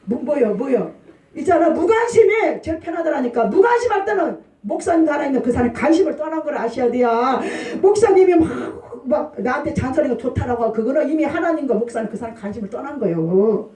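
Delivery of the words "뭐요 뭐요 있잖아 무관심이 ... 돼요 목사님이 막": ordinary talk, nothing marked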